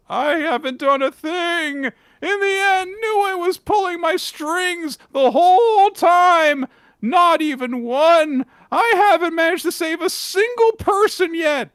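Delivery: despairing Gakuro. Acted. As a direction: cry it out, fists clenched. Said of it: "and he says".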